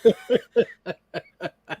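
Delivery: high, loud laugh at the twist; real laugh after the reveal; burst of laughter. Real, not acted.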